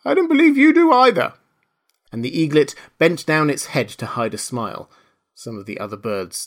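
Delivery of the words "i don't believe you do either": high, loud, indignant retort; pert retort. A narrator's reading. Bird challenging a pompous speaker, indignant. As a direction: pipe up loudly in protest.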